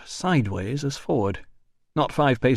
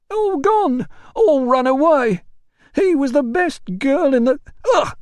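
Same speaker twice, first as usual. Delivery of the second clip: high loud wail breaking into a cry; drunken wail; 7 dB louder than usual. Acted. Drunk porter wails.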